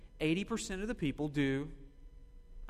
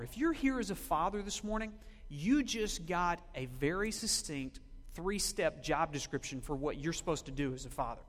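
ordinary talk

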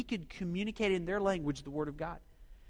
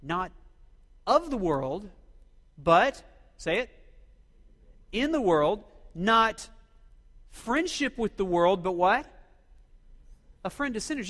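loud, emphatic call to the congregation; full-force preaching; 7 dB louder than usual. Acted. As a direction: drive each phrase home to the room.

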